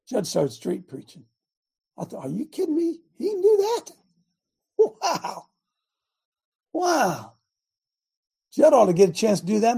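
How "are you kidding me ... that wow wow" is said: high, astonished disbelief; amazed. Retold or imitated. Preacher recalling his shock at Judd preaching, high and amazed. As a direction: gasp out your amazement at him.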